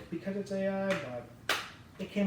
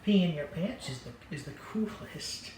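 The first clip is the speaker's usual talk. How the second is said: half-done movie quote. Retold or imitated. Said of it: "I was gonna do the accent".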